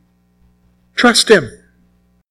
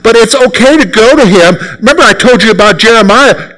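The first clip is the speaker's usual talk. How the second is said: usual speaking voice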